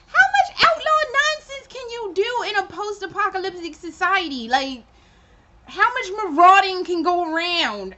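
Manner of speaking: mocking rhetorical question; incredulous mock. Real, not acted. Mocking biker outlaw nonsense.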